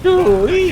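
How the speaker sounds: high loud squealing "Sue-wee"; monster screech imitation. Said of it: "they're the ones who make that".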